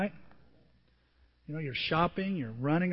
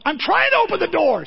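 high, loud exasperated shout; going off on her; 11 semitones higher, 10 dB louder than usual. Retold or imitated. Husband yelling through the window at his wife who cannot hear, angry shout. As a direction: bellow it, fed up and helpless. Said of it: "sees me going off on her".

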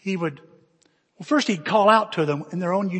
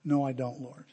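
soft, low and contrite; humbled admission; 5 semitones lower, 8 dB quieter than usual. Retold or imitated. Man answering the Lord after meltdown, humbled. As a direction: admit it quietly, voice dropped.

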